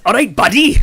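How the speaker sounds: high, loud Welsh greeting; cheery old Welsh guy hailing his grandson; Welsh granddad impression. Granddad greeting the grandson, imitated in a Welsh accent. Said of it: "the way he says".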